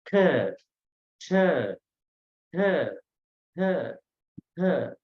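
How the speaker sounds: K, ch, th each with a strong puff of breath; aspirated consonants. Shown as a demonstration. Teacher showing students Pali letters, K, ch, th heavily aspirated. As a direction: push breath out on K, ch, th. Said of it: "with a puff of breath".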